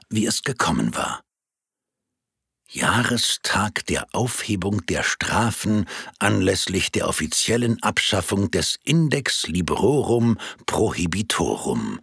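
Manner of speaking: ordinary delivery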